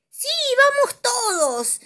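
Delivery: high, slow, loud cartoon voice; cheerful critter chorus; animals agreeing. Forest friends agreeing to play, high eager voice. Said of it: "dijeron el ratón".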